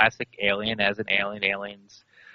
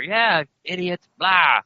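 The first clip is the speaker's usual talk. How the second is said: higher, drawn-out jeer; mock heckler; 6 semitones higher, much slower than usual. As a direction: imitate scoffing listeners out there. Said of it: "I'm sure there's people out there".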